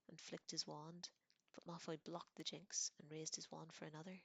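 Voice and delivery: ordinary delivery, nothing marked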